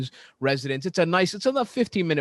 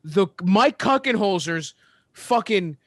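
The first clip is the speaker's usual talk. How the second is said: exasperated rant; 7 semitones higher than usual. Real, not acted. Venting about the game loss.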